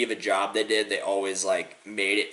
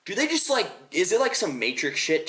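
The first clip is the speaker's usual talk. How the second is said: baffled disbelief; 12 semitones higher, 5 dB louder than usual. Retold or imitated. Baffled kid questioning the Disney Channel trick, incredulous. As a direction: exclaim like a kid stumped by a mystery. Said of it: "I was like".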